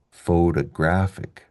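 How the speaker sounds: slow "photographic" stressed on "graph"; "photographic" drawn out, strong on its third syllable; second-last syllable stress. Shown as a demonstration. Teacher contrasts photography, "photographic" stressed second last. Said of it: "which is very different than above here".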